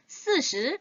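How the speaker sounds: 스, 시 said as si shi; 스 crisp then 시 curled; 스, 시 joined into sishi; sishi demo. Shown as a demonstration. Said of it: "두 개 같이 붙이면 사십이 되죠".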